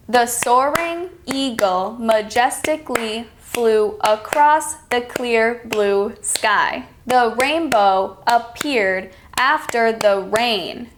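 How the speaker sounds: slow, syllable by syllable, one beat per clap; slow clapped-out reading. Shown as a demonstration. Teacher clapping out a sentence for students, slow and even. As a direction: read it slowly like you're clapping each syllable. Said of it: "I will clap them out".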